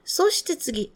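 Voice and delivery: ordinary talk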